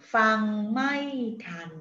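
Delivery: "Fang mai tan." spoken very slowly, each tone marked; slow tone demo. Shown as a demonstration. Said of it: "you go up and then you go down". (Fang mai tan said slowly for the learner)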